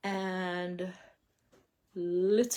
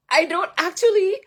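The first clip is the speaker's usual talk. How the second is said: emotional high; 11 semitones higher, 11 dB louder than usual. Real, not acted. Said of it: "emotional retelling".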